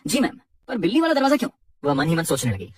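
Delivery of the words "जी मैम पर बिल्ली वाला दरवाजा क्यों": a puzzled secretary, pitched higher; surprised. A narrator's reading. Secretary questions her boss, surprised and puzzled. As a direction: ask it with a startled, confused lift. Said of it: "secretary हैरान होकर".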